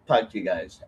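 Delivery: normal talking voice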